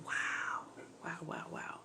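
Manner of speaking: hushed, breath-only amazement; barely voiced awe; awed whisper. Real, not acted. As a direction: whisper it to yourself in wonder. Awed reflection after the story.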